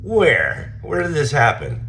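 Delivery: pressing the manager for answers; shocked demand. Retold or imitated. Questioning the manager about the accusation, sharp and high.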